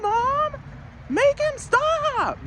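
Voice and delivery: put-on very high, slow, whining child calling for mom; whiny ten-year-old. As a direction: tattle to mom like a whiny kid. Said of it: "that's what a ten-year-old would do".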